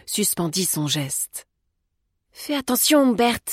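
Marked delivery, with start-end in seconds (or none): 2.3-3.4 raised pitch, anxious caution; worried plea to be careful; fearful warning. A narrator's reading. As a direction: warn him timidly, voice lifted. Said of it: "dit-elle avec crainte".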